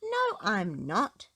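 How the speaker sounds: slow, a touch sulky; lightly cross little girl; mildly cross. A narrator's reading. Susie mildly cross at Peppa's accusation. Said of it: "Susie said crossly".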